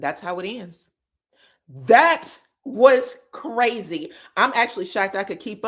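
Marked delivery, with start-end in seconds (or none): 1.7-4.1 very slow, louder, each word set apart; emphatic amazement. Real, not acted. Recapper reacting to the fight, emphatically amazed. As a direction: land every word separately with weight. Her own verdict after the recap.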